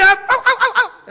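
high, loud string of yaps; dog barking reply. Acted. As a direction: answer the call as a barking poodle. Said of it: "הוא צועק". (נביחות פודל רמות)

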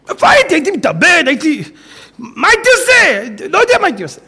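high, straining, near tears; pleading emotional cry. Acted. Menachem to the rabbi, high tearful pleading. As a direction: plead with a breaking heart.

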